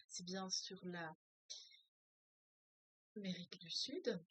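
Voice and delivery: ordinary talk, nothing marked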